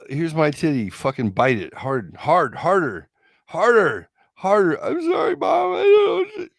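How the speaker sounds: rising from firm to high, loud shrieking; building frantic demand. Acted. Masochist demanding her partner bite harder, building to shrieks.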